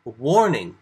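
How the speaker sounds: "Warning," called out loud with a fall; "Warning," loud and falling, as Jesus warns. Shown as a demonstration. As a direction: bark "Warning," as an alert. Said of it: "it's more like warning".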